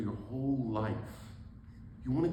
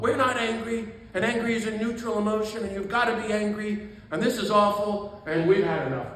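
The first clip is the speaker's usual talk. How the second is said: a mock yell, higher and louder; imitating angry nuns; 6 semitones higher, 7 dB louder than usual. Preacher to congregation, imitating nuns yelling at the retreat. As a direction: retell the nuns' outburst as a hammed-up rant. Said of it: "they stood up and yelled at me".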